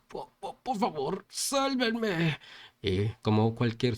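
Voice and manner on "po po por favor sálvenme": stammered, high-pitched plea; stutter, then rise into a cry for rescue; pleading cry. A narrator's reading.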